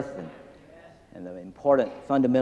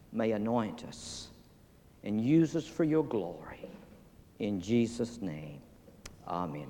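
prayer. Acted. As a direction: pray humbly over the people. Leads prayer.

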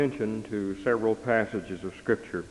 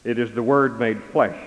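ordinary talk, nothing marked

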